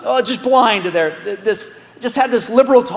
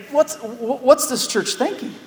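puzzled Paul; 6 semitones higher than usual. Retold or imitated. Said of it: "He's scratching his head thinking".

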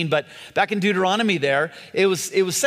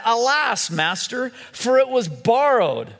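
a hint of alarm, raised and higher; crying out in dismay; 6 semitones higher than usual. A narrator's reading. Student cries to Elisha over the borrowed axe, dismayed cry. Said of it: "he cried out and said".

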